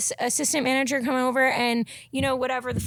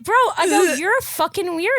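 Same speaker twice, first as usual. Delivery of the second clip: higher, louder retold snap at the guard; retold confrontation; 4 semitones higher, 4 dB louder than usual. Retelling how she told off the security guard, high and loud. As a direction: call him out again in the retelling.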